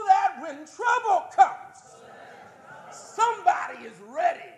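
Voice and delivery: slow, loud climax of the prayer; rising shout. Acted. Preacher lifting the church's prayer to a loud slow shout. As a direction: drive the words out at full volume.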